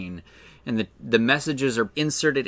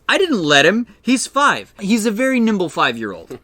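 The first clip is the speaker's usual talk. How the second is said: high, loud defensive protest; mock-exasperated, raised-voice comeback; Edgar; 8 semitones higher, 6 dB louder than usual. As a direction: act out a flustered excuse, pitched up. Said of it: "And Edgar goes".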